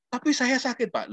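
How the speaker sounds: high, plaintive student voice; hurt student. Retold or imitated. Lecturer voicing his student insisting it hurts, high and plaintive. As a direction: plead like a wounded student.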